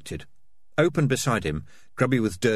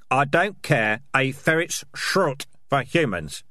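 higher, slow, emphatic snap; snapped retort; 6 semitones higher, 3 dB louder, slower than usual. Acted. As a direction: dismiss it angrily, leaning on each word. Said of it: "snapped Dark Tan".

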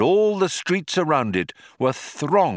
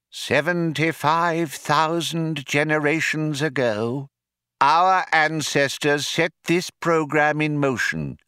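higher, measured character voice; second man, portentous; 4 semitones higher than usual. A narrator's reading. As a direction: intone it slowly as a momentous announcement. Second man speaks solemnly in story.

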